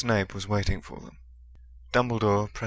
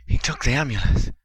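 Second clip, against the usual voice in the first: gasping; 5 semitones higher, 6 dB louder than usual. A narrator's reading. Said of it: "Harry gasped".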